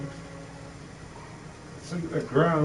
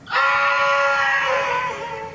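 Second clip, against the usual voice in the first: loud, high scream of "ah"; sudden shout; 12 semitones higher, 4 dB louder than usual. Acted. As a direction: let out a sharp high yell. Sudden outburst in rant.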